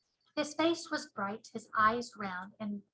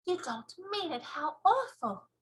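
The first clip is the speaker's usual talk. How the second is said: put-on little girl voice; 7 semitones higher than usual. Sophie horrified.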